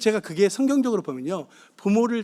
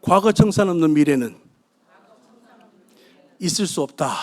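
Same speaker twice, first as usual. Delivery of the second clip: a rallying cry, louder and measured; loud proclaimed slogan; 2 semitones lower, 6 dB louder than usual. Shown as a demonstration. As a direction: proclaim it for the crowd to repeat. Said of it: "자, 따라서 하십시오".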